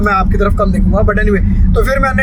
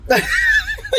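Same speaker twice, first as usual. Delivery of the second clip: high burst of laughter; shrieking laugh; 5 dB quieter than usual. Real, not acted. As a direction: laugh hard at the joke. Laughing at joke.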